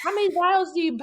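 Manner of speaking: high, fast, incredulous retold question; imitating her own bewildered question to the nurse; flustered disbelief. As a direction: sound like you can't believe the numbers.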